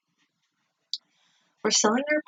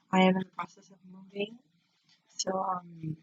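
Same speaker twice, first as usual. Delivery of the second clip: ordinary talk, nothing marked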